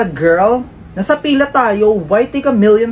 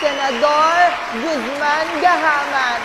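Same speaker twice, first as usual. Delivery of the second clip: high put-on emcee voice; campaign emcee voice; 7 semitones higher, 4 dB quieter than usual. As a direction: hype up the crowd like a host. Mock campaign host voice.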